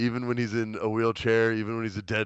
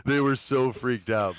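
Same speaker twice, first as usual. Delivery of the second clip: normal talking voice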